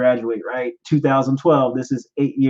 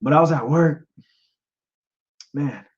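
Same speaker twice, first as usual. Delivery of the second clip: choked up. Real, not acted. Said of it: "Get choked up".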